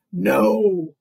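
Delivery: loud, firm denial. Acted. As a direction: bark a firm no.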